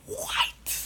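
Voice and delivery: high whispered angry "What?" from the dad; mad dad. Retold or imitated. Dad finds his hurt son, startled and angry. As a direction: imitate the furious father's breathy gasp. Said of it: "Like, he was mad".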